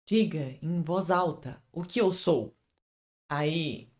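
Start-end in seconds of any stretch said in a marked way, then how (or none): 0.1-2.5 soft, slow dramatic vampire line; Edward line. Acted. Quoting Edward to Bella, slow hushed drama. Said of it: "da Bella que o Eduardo fala".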